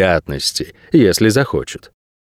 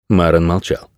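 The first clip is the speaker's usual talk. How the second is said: ordinary delivery, nothing marked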